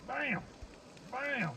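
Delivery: high, punchy celebrity chef catchphrase; Emeril Lagasse bam. Retold or imitated. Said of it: "bam, Emeril Lagasse it".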